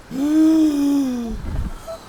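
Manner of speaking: high startled gasp at the troll; sharp high gasp. Real, not acted. Gamer spotting the troll behind sister, gasping high. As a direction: catch your breath in alarm.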